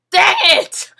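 loud, high yelp of annoyance; frustrated shout. Real, not acted. Gamer shouting after dying in the lava. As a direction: blurt out the frustration. Dies in game.

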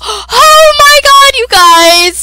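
high, very loud drawn-out plug; over-the-top excited screaming; screamed hype. Acted. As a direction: shriek it at your viewers, stretching the words. Hyped shrieking plug to viewers.

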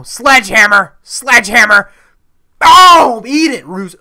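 high, full-voiced shouting; excited battle yell. Real, not acted. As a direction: yell it as you swing the weapon. Attacking opponent with a weapon.

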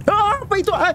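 high startled yelp; startled scream. Real, not acted. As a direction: jump at the scare. Jump scare in horror game.

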